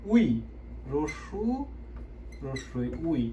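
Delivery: উই said softly and slowly; name the letters, then blend উই; soft vowel demo. Shown as a demonstration. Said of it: "এই যে লম্বা ডাকটি". (নরম স্বরে উচ্চারণ)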